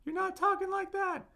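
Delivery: very high falsetto demo; squeaky-high, held falsetto pitch. Shown as a demonstration. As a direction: flip right up into falsetto. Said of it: "You're not going into falsetto".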